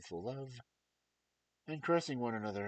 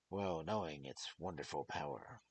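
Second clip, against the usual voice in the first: ordinary delivery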